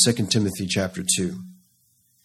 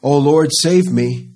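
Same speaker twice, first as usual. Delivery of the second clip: slow, louder pleading cry; slow, louder prayer calling to God; 4 semitones higher, 6 dB louder, slower than usual. Acted. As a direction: stretch it out like a desperate call for mercy. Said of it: "When David cries out".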